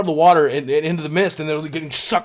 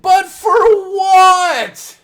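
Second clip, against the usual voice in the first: crying plea; 10 dB louder, much slower than usual. Retold or imitated. Zookeeper pleading, arrested.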